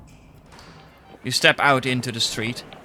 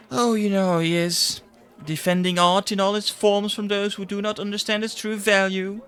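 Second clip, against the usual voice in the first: high, airy, mocking voice; mocking secretary; 9 semitones higher, 3 dB louder than usual. Secretary mocking the editor's art crusade, high and airy. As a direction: roll your eyes through it.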